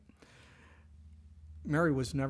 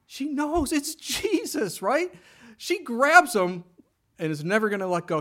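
preacher excitedly telling how Mary seizes Jesus; 9 semitones higher, 7 dB louder than usual. Real, not acted. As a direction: build the thrill of recognition. Excited retelling of recognition.

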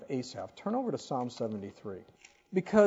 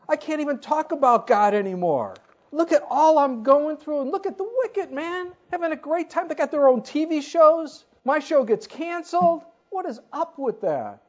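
higher, louder exasperated complaint; Asaph fed up; 11 semitones higher, 8 dB louder than usual. Retold or imitated. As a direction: vent like a man who's had enough. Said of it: "That's how bad it was".

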